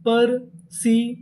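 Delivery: slow, drawn-out perseverance, syllable by syllable; slow sounding out. Shown as a demonstration. Teacher answering her own question slowly for students. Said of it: "पूरी meaning क्या बनी?".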